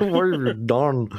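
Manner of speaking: imitating a whiny Lucas; whiny George Lucas. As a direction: whine it like a crushed creator. George Lucas impression continued.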